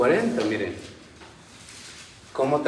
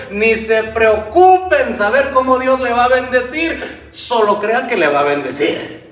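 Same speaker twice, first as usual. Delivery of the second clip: loud, slowed preaching push; preaching at full force; 8 semitones higher, 8 dB louder than usual. Acted. Preacher urging the congregation, at full force. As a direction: drive the promise home to the congregation.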